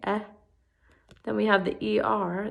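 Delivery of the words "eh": EH as an isolated short vowel; short E sound. Shown as a demonstration. Teacher sounding out the first vowel, short E. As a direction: sound out EH on its own. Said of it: "We have the short E".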